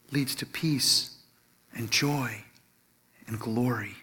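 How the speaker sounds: soft, low, slow, phrase by phrase; hushed reverence. Real, not acted. Preacher names where the other path leads, hushed reverence. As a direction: sink gently into near whisper.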